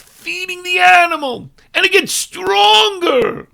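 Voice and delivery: high, loud, slowing shout; preaching at full force. Real, not acted. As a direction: thunder the warning. Preaching climax.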